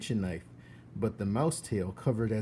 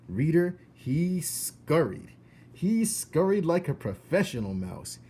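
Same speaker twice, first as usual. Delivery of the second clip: excited narration; 7 semitones higher, 5 dB louder than usual. A narrator's reading. Narrator addressing the reader, excited and emphatic. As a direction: spell out the escape with relish.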